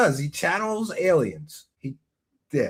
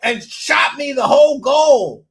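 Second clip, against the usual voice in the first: loud, high, hammed-up preacher praise; mock preacher shout; 7 semitones higher, 10 dB louder than usual. Hyped thanks to donor.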